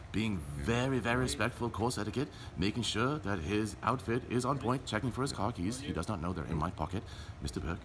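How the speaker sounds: hushed commentary. Pretend. Commentator confiding about the car keys in hushed tones. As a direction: murmur like a golf announcer.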